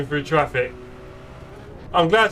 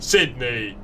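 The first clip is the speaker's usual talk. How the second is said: ordinary talk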